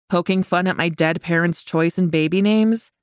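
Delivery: ordinary delivery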